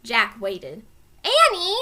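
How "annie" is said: loud high call. A narrator's reading. Jack calling his sister in the woods, loud call. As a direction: call her name into the woods. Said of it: "he called again".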